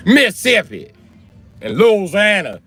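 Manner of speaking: high, loud Mississippi as "Missippi", Louisiana as "Loozanna"; mocking high-pitched Mississippi and drawn-out Louisiana; mock Southern accent. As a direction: exaggerate the dropped syllables in Mississippi and Louisiana. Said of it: "I'm tired of Louisiana people accent".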